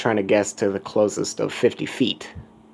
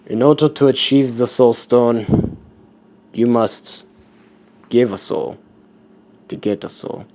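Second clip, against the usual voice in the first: put-on skull figure's emphatic German accent; lightly German-accented proclamation; attempted German accent; 3 semitones higher, 6 dB louder than usual. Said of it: "trying to do a German accent".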